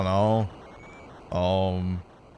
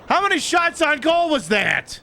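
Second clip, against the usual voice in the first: much higher, shouted question; exasperated shout; 12 semitones higher than usual. Real, not acted. Gamer yells at the game over the shot count, exasperated. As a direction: yell it in disbelief at the screen.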